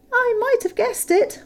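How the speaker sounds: butter up the dragon; hobbit flattery. A narrator's reading. Bilbo flattering Smaug, high and eager.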